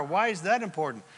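normal talking voice

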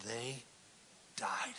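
hushed, grave whisper; hushed reveal. Acted. Preacher hushing as he reveals the sons died.

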